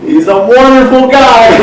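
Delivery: high, loud, slow laughing praise; gushing with laughter. Retold or imitated. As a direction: gush about him, laughing. Friend gushing about husband.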